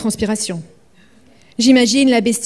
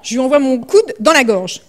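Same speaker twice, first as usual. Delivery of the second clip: ordinary delivery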